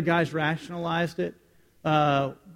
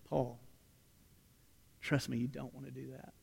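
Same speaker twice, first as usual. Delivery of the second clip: much softer, lower confiding advice; quiet warning; 5 semitones lower, 14 dB quieter than usual. Retold or imitated. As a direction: hush it like an older friend. Senior partier warns him gently.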